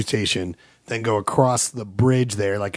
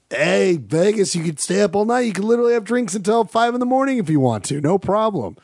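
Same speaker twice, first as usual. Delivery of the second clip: mock Vegas hype; 8 semitones higher than usual.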